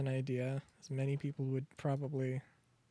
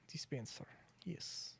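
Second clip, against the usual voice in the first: whisper; 10 dB quieter than usual. Real, not acted. Sneaking, hushed to self.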